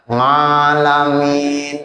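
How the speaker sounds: long ng-started "ngalamin"; wrong "ngalamin". Shown as a demonstration. Teacher imitates the mistaken verse, "ngalamin" with ng. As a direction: chant "alamin" slowly with ng for 'ain. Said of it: "bukan ngalamin".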